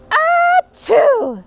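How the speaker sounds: loud, high, drawn-out acted sneeze; big sneeze. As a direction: let a huge theatrical sneeze burst out. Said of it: "And I sneezed a great sneeze".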